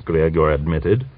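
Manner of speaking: ordinary delivery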